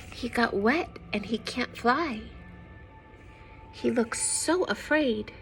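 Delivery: plain reading voice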